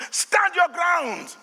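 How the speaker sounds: high, drawn-out preacher's shout; rousing exhortation. Real, not acted. Preacher urging church to stand, high slow shout. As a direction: ring it out slowly as a rallying cry.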